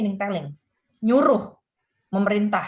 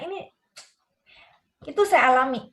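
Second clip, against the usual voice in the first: usual speaking voice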